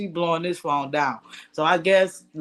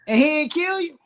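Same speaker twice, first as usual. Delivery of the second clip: shocked disbelief; 9 semitones higher, 3 dB louder than usual. Real, not acted. Disbelief at murderer catfish story.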